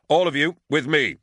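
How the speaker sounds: rally the troops to follow; commanding call. A narrator's reading.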